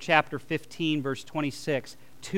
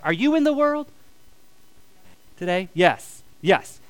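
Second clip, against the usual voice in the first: normal talking voice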